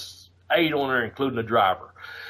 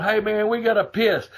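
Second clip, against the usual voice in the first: imitating the boys; 7 semitones higher than usual. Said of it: "they're going".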